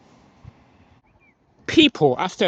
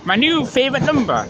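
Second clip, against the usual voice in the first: excited; 9 semitones higher, 3 dB louder than usual. Real, not acted. Excited trainspotter announcing number.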